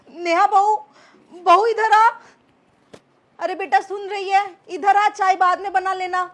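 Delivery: loud call across the house; mother-in-law calling the daughter-in-law from another room. Acted. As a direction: raise the voice to call her over.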